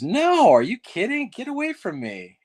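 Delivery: high, loud scoff then dropping; mock snap of disgust; Chevy Chase brush-off. Chevy Chase shooing off a fan, mock loud scoff. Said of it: "You know, like that".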